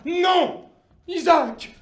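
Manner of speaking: crié, plus aigu et fort; refus tonnant. Acted. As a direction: claque le refus. Dieu refuse le marchandage. (Dieu exige Isaac, crié)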